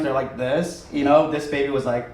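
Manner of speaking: normal talking voice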